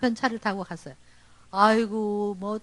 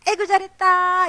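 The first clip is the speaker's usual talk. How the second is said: praising mother; 6 semitones higher than usual. Retold or imitated. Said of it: "엄마가".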